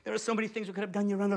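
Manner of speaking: normal talking voice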